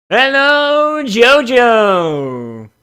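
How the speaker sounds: high, loud sing-song greeting; hammy hello. Pretend. Streamer greeting the pony with a hammy hello. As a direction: greet him like a game show host.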